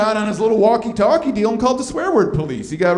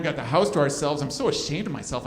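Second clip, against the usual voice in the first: ordinary talk, nothing marked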